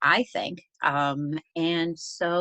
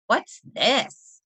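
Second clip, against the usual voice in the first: put-on higher little-boy voice, wondering; curious little boy; 9 semitones higher, 4 dB louder than usual. Little boy hunting a truck book finds it, higher curious little-boy voice. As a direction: ask it like a small boy spotting something new.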